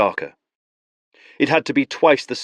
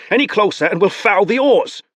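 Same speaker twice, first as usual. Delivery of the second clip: alarmed warning; 12 semitones higher, 5 dB louder than usual. Acted. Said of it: "Givret blurted".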